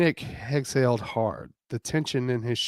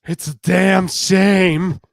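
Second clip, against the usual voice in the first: loud slow drawn-out complaint; Justine voice; 7 semitones higher, 11 dB louder, slower than usual. Acted. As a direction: drag out the regret, loud. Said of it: "Justine muttered".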